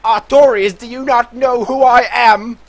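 loud, outraged British nobleman; demanding aristocrat, almost shouting; posh British accent. Acted. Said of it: "this accent".

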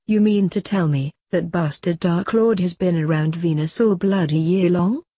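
ordinary delivery

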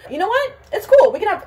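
high-pitched talking herself down; forced cheer. Real, not acted. Vlogger reassuring herself mid-haircut, high forced cheer. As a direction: pitch it up, insisting all is well.

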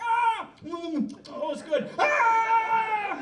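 high shouts broken by a happy mm; scream, savor, scream again; a pilot yelling high, pausing to enjoy the pie; screaming, tasting pilot. Acted. Screaming pilot tastes pot pie.

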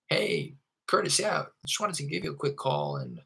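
high, stretched-out phone greeting; sales script demo. Shown as a demonstration. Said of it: "with that upward inflection".

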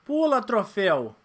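slow loud yell at the screen. Real, not acted. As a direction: stretch out a frustrated yell. Yelling at game.

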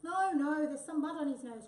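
loud, high, urgent cry. A narrator's reading. Tabby interrupting Wombat's photograph, crying out. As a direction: burst in loudly with alarm. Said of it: "cried Tabby".

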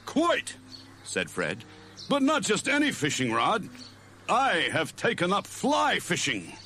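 high puffed-up caveman voice; cartoon Fred, pompous. A narrator's reading. Fred boasts about his rod to Barney, pompous cartoon voice. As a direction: show off grandly. Fred's voice.

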